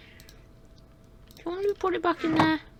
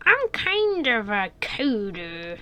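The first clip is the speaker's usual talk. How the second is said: slow, croaky, lightly put-on coder; 5 semitones lower, 3 dB quieter than usual. Night-shift coder Wilbur introducing himself to the guard, croaky voice. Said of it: "Look at your voice just changed".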